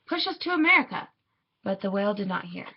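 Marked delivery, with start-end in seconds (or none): 0.1-1.1 high, lifted pleading call. A narrator's reading. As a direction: call out high across the water. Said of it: "my mother called".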